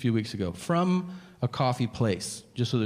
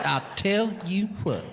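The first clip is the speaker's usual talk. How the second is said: slow, high cowboy drawl; cowboy persona; 8 semitones higher than usual. Retold or imitated. Man thinking he's a cowboy, slow exaggerated drawl. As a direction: stretch it out as a mock cowboy. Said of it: "they have adopted the cowboy persona".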